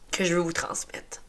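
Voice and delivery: whispering through tears; choked, tearful whisper; crying whisper. Real, not acted. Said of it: "moi qui pleure de même". (chuchoté en pleurant)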